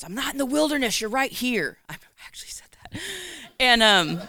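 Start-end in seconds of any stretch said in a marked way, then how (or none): 0.0-1.8 high, loud, arguing back; defiant retort. Retold or imitated. To God in the truck, a high defiant retort. 1.9-3.0 whispered, quick admission; whispered confession. Real, not acted. To the congregation, a quick whispered confession.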